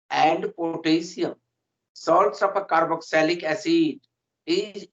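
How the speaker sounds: very slow, word by word dictation. Acted. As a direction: dictate each word so students can write it down. Dictating definition to students. (धीमे-धीमे लिखवाना)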